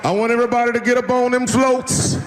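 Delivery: rally the crowd like a march leader; preacher voice. Pretend. Martin Luther King rallying marchers, high and preacherly. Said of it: "If Martin Luther King was like".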